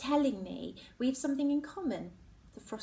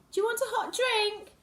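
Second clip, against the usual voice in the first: high, raised call from another room; 13 semitones higher, 6 dB louder than usual. Acted. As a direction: call out an offer of a drink. Mum calling to her daughter.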